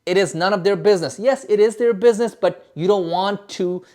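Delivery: usual speaking voice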